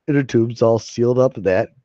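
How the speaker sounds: ordinary talk, nothing marked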